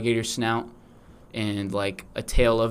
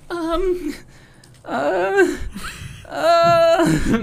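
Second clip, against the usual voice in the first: much higher nervous stammer; scared kids; 4 dB louder, much slower than usual. Acted. Classmates stammer at the alligator baby, high and scared. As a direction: stammer like a frightened kid. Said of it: "they're just like".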